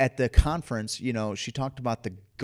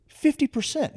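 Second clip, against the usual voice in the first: FIFTY PERCENT punched very high and louder; "Fifty percent" exclaimed high and loud; indignant emphasis; 5 dB louder than usual. Real, not acted. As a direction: hit "Fifty percent" high and hard, in protest. Returns indignantly to the prison statistic.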